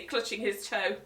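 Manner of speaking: ordinary delivery, nothing marked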